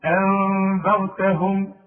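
أنذرتهم drawn out slowly, nun hidden nasally before the dhal; إخفاء بغنة; slow tajweed recitation. Shown as a demonstration. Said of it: "اخفي وغن".

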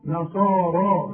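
"نصارى" slow, ending on a long alif sound, not ya. Shown as a demonstration. Teacher showing that "نصارى" is pronounced with an alif. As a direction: draw out "نصارى" and end it on an alif. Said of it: "تكتب هكذا وتنطق".

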